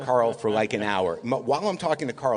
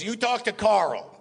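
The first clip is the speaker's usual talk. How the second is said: high, drawn-out mock order; Mel Brooks; 8 semitones higher, slower than usual. Mel sending the guest to Carl, mock-emphatic instruction. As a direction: imitate emphatic advice, slow and pitched up. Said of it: "He goes".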